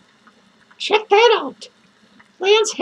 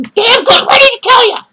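mock shout; 4 semitones higher, 7 dB louder than usual. Teasing viewers about the box.

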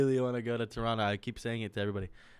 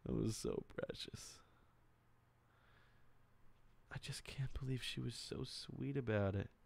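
soft whispered awe; whispering, still moved by the fan; hushed whisper; 9 dB quieter than usual. Real, not acted. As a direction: breathe it out as if overcome. Emotional reflection, voice drops.

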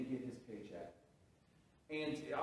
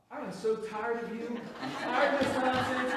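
louder and higher, fed-up retelling; annoyed outburst; 9 semitones higher, 7 dB louder than usual. Retold or imitated. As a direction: snap back at the pestering teen. Retold angry brush-off.

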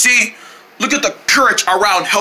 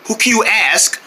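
ordinary talk

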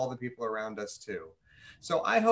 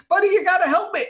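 high, loud desperate cry for help; shrill-high, loud frightened friend; panicked plea; 10 dB louder, faster than usual. Acted. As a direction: beg your buddy as the boat sinks.

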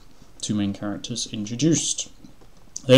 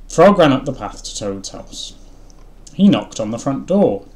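louder, higher read-aloud voice; story narration; 4 semitones higher, 11 dB louder than usual. A narrator's reading. Reading a children's book aloud, projected narration.

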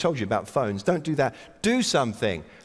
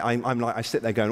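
normal talking voice

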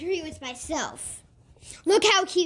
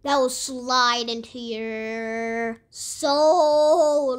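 low, slow spooky drawl; 7 semitones lower, much slower than usual. Pretend. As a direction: creep them out slowly.

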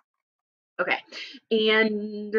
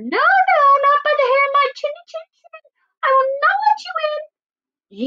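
very high, squeaky pig voice; defiant little pig; 4 dB louder than usual. Acted. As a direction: refuse in a piercingly high piggy squeal. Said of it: "The little pig grunted and answered".

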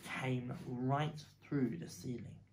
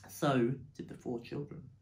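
usual speaking voice

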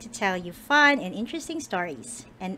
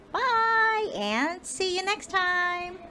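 cheerful goodbye; 10 semitones higher, much slower than usual. Real, not acted. Farewell to viewers.

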